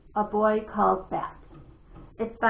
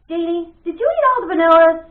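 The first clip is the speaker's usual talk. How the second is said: loud, higher, accusing; loud accusing question; 7 semitones higher, 11 dB louder than usual. Acted. Boy accuses sister over the yogurt, loud and indignant. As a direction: demand to know, raised voice.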